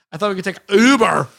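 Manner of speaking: mock Scottish accent. Podcaster riffing on silly pronunciations, in a mock Scottish accent. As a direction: hit Uber hard in a put-on Scottish accent. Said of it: "Like it's Scottish".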